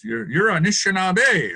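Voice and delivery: an elder setting him straight, slowly and firmly; firm correction. Retold or imitated. Elder correcting the speaker's slang, firm and slow. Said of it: "And he goes".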